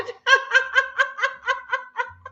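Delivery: high, rhythmic forced giggle; deliberate laughter. Shown as a demonstration. Laughter yoga host shows newcomers, high forced laugh. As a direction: laugh on purpose in high, even pulses. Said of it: "What the heck am I laughing at".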